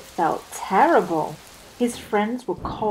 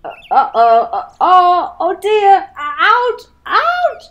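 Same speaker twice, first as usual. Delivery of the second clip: rising panicked cries, louder and much higher to a squeak; panicked rabbit; 11 semitones higher, 10 dB louder than usual. Acted. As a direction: panic building as the house bursts. Rabbit panics as house bursts.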